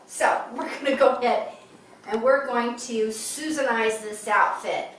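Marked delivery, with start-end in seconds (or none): none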